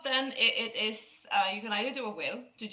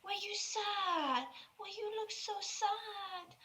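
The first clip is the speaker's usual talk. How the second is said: put-on tender girl; 10 semitones higher, slower than usual. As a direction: soothe them like a sad child. GM voices the girl comforting.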